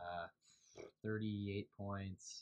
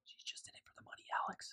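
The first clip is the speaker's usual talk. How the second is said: hushed, voiceless mock warning; joking whispered aside. Friend slyly warns Alex about his girlfriend, joking whisper.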